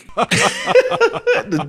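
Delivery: high burst of real laughter; laughing. Real, not acted. Vlogger laughing at the ousted president.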